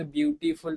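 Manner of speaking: Beautiful with beauty lifted, then फुल after it; rising then dipping rhythm. Shown as a demonstration. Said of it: "पहले एक rhythm चढ़ा beauty".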